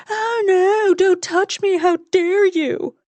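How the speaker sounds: mock her as a fussy, offended girl; high mocking impression. Wes mocks Carly to her face in a high, sneering girly voice. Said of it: "his high, mocking impression of me".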